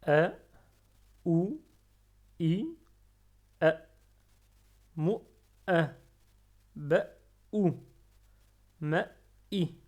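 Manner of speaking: voyelles et syllabes détachées, fort; récapitulation. Shown as a demonstration. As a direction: reprendre chaque son bien articulé. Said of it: "On reprend". (reprise claire des syllabes)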